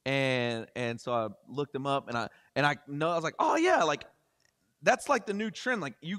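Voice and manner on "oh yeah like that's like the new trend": sudden recognition. Retold or imitated.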